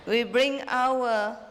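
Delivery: slowed-down question broken by laughter; laughing through it. Real, not acted. Master rereads the disciple's question, laughing. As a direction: try to read it but keep cracking up.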